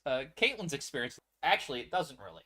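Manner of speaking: ordinary delivery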